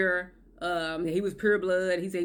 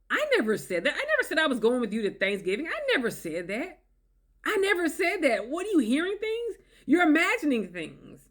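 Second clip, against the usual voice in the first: rising, insistent higher denial; mock insistent denial; 6 semitones higher than usual. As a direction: insist you never said it. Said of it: "gaslighting and denial".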